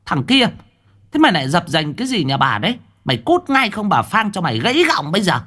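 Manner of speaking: put-on high, fast old-wife tirade; angry scolding. A narrator's reading. Woman chasing a prowler from her gate, angry scolding. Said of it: "bà chỉ tay quát".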